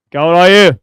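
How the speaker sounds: loud teacher's shout at a class; sudden loud demand for silence; silencing shout. Shown as a demonstration. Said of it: "बच्चों को चुप कराना होता है".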